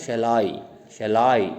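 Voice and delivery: shall I with light shall, stronger I; stress on I. Shown as a demonstration. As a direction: lean on I, pass quickly over shall. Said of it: "stress कम देंगे, आई पर ज़्यादा देंगे". (shall I में I पर ज़्यादा ज़ोर)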